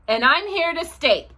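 indignant announcement, lightly acted; snapping kangaroo. A narrator's reading. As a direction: snap it like a bossy kangaroo. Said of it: "snaps the big kangaroo".

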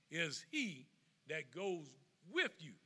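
ordinary talk

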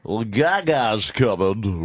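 slow, deadpan announcer parody; mock radio voice. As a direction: drag it out like a cheesy DJ.

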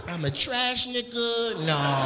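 slowed, half-laughing mock of a smug trash man; mock garbage man. Comic imitating the sanitation engineer, slow and lightly smug. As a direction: savour each grand title, a little smug. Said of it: "He was like".

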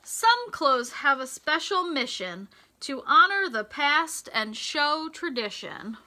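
bright, sing-song storybook read; lilting picture-book voice; reading to children. Acted. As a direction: read the rhyme to a class of little kids. Reads rhyming picture book aloud.

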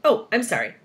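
ordinary delivery, nothing marked

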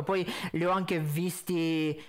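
usual speaking voice

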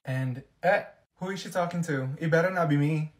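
usual speaking voice

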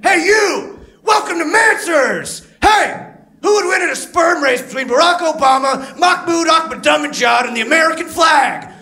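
very high, loud shouting announcer; monster truck ad. Retold or imitated. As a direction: yell it like a monster truck promo. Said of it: "narrated by that guy who does monster truck ads".

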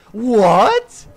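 loud, escalating to a high shriek; hammed-up outraged astonishment; shocked. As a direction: yell in mounting mock disbelief.

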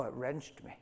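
ordinary delivery

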